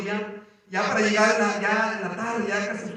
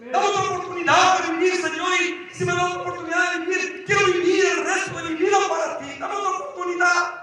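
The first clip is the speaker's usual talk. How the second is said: desperate plea; 7 semitones higher, 3 dB louder than usual. Retold or imitated. Retold desperate prayer inside the whirlpool.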